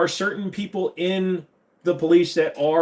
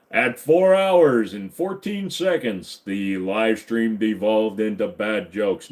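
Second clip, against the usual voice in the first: mock narrator; 4 semitones lower, slower than usual. Mock documentary narration of stream.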